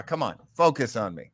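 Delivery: ordinary delivery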